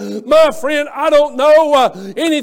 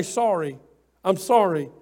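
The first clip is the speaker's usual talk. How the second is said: humble apology; 6 semitones lower, 5 dB quieter than usual. Retold or imitated.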